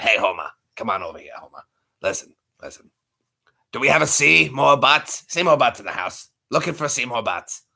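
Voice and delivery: low cartoon bartender impression; Moe. Shown as a demonstration. Showing chat a Simpsons bartender, low character voice. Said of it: "I can do Moe a little bit".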